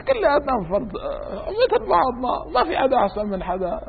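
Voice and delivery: high, laughter-broken speech; laughing. Real, not acted. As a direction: talk through a real laugh. Laughs while teasing about self-importance.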